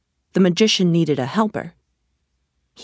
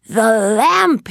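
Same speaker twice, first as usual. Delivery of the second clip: angry cry; 4 semitones higher, 4 dB louder than usual. A narrator's reading. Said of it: "he cried".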